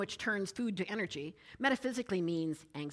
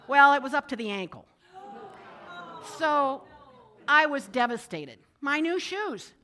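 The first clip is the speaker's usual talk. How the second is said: much louder, higher retold dismay; 6 semitones higher, 9 dB louder than usual. Telling listeners about the cow pie, loud dismay. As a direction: wail over the ruined shoes. Retelling ruined new shoes.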